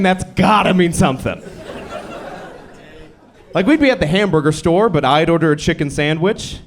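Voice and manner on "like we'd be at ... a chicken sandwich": ordinary talk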